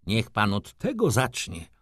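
usual speaking voice

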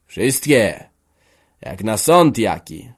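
loud jeer, drawn out on the second phrase; a touch of derision, punched words; mocking. A narrator's reading. Father greets his assembled children, mocking. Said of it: "zapytał drwiąco".

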